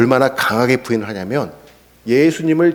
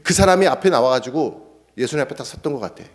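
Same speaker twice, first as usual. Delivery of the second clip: ordinary delivery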